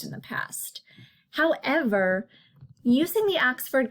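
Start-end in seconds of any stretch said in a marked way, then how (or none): 0.0-0.8 whispering. Real, not acted. Aside in lesson.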